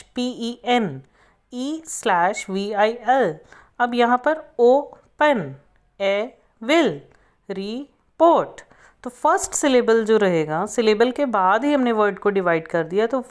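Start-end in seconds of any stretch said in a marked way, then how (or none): 4.6-8.6 each word broken before its middle consonant, ओ-पन, ए-विल, रि-पोर्ट; split into syllables. Shown as a demonstration. Teacher demonstrates division before a single consonant, syllable by syllable. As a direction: pause inside each word, second syllable given full weight. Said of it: "divide before a single middle consonant".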